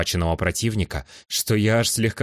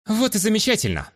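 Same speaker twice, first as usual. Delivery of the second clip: bright-eyed, high-pitched approval; cheerful exclamation. Acted. Young man clapping his hands before offering escort, cheerful.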